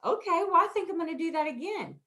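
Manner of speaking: put-on a light, high voice of a grinning kid; lightly voiced happy child; pleased child. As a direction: play a chuffed little girl. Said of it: "Sophie grins like".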